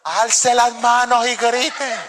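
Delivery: slowly drawn out, with a laugh; recalled instruction. Retold or imitated. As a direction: remember it mid-ride, laughing. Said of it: "me acordé fue esto".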